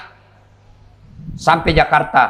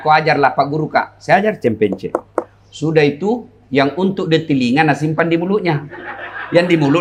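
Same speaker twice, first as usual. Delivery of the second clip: ordinary delivery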